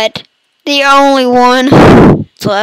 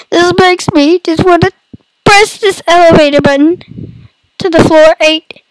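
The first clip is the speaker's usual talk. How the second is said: higher, mock tearful whine; fake crying; 4 semitones higher than usual. Gamer pressing the elevator button, fake crying. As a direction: pretend to cry through the words. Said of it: "I'm fake crying if you can't tell".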